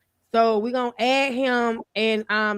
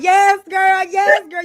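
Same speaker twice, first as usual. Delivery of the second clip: higher, louder hyped-up cheering; hammed-up cheering along; mock hype cheer; 8 semitones higher, 8 dB louder, slower than usual. Host imitating herself cheering on a muted mic, hammed up. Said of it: "I'm like".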